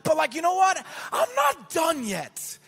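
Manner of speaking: high, loud, stretched-out exhortation; impassioned sermon climax, shouted high; preaching at full force. Real, not acted. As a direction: drive the church on with rising shouts.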